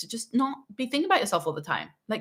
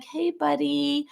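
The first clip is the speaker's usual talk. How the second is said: higher, very slow syrupy "Hey, buddy"; sugary sweet parent; 6 semitones higher, much slower than usual. Retold or imitated. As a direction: drip fake sweetness through a forced smile. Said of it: "putting a sugary smile on their face".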